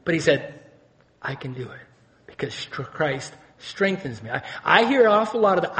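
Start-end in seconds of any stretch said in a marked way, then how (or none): 1.2-4.3 low, soft, halting confession; quiet resolve. Retold or imitated. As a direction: voice Paul's hushed trust from prison. Paul's quiet resolve from prison.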